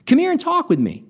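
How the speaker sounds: a lightly voiced old man, pitched high; easy friendly invitation. A narrator's reading. Old man inviting the drunk over, a hint of high old voice. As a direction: beckon kindly like an old grandfather. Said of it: "in an easy vernacular".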